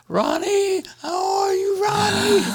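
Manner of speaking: very high squeaky falsetto, gently calling; mother's new squeaky voice. Retold or imitated. Mother calling her son on the phone, very high squeaky falsetto. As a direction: squeak it way up like her voice after surgery. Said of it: "she called me on the phone".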